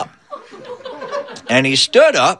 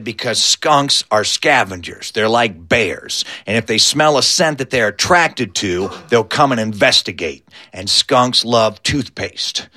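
mock grave; 8 semitones lower than usual. Skeeter warns Uncle Debo about skunks, mock grave. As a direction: explain it with deadpan gravity.

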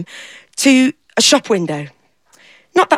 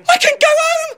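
high, thrilled exclamation; gasp, then cry out with relief; joyful cry. Acted. Jean realising she can go home, thrilled.

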